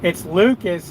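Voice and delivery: normal talking voice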